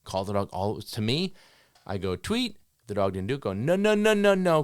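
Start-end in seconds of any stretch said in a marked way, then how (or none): 2.2-2.6 high, rising mock whistle "tweet"; imitated whistle blast; whistle sound. Shown as a demonstration. 3.5-4.6 high, loud repeated no; verbal correction. Shown as a demonstration. Correcting dog demo.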